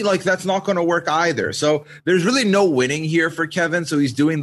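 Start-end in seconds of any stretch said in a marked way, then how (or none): none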